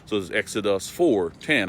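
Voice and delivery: ordinary delivery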